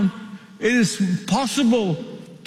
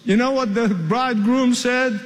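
ordinary talk

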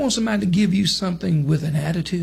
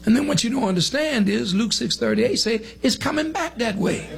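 usual speaking voice

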